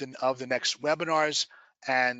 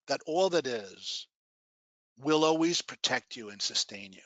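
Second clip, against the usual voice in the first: guided meditation. Acted. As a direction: guide them gently into relaxation.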